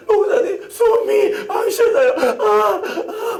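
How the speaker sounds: put-on high, slow, choking child voice; a frightened child who can't breathe; gasping child. Voicing struggling child.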